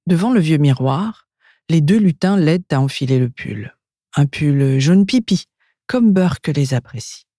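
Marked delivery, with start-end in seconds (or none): none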